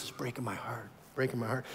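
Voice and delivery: very soft, low, grieving lament; heartbroken God. Acted. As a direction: let the words sink with sorrow. God's grief voiced again.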